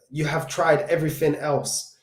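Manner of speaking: usual speaking voice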